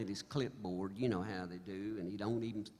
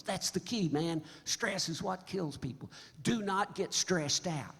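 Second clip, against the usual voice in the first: higher-pitched imitation of an insistent doctor; emphatic warning; 7 semitones higher than usual. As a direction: drive the advice home, slowing at the end. Doctor's earnest warning, acted out.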